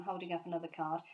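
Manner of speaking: ordinary delivery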